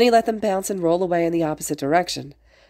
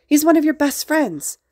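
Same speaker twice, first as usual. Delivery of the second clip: very high, louder protest; exclaiming; 11 semitones higher, 5 dB louder than usual. A narrator's reading. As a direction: insist in astonished disbelief. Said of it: "the brown-haired girl exclaimed".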